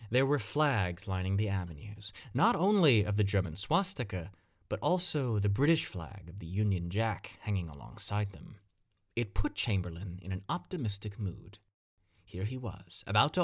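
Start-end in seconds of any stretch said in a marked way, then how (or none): none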